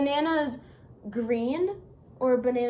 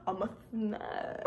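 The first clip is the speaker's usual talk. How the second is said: put-on Southern accent; 3 semitones lower, 6 dB quieter than usual. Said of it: "can't talk in my Southern accent".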